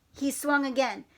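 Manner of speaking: ordinary delivery, nothing marked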